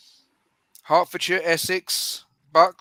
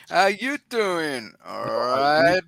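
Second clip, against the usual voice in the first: slow, drawn-out mock catchphrase; imitated sitcom greeting, dragged out; Joey; much slower than usual. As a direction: stretch out each greeting word playfully. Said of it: "the perfect response would be".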